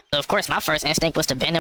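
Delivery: usual speaking voice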